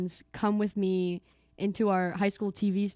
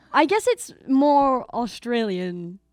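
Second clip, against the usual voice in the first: slow, attempted Australian accent; put-on Australian lilt, slow and tentative; 2 semitones higher, 4 dB louder, slower than usual. Shown as a demonstration. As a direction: have a go at an Australian accent, drawn out. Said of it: "that was Australian".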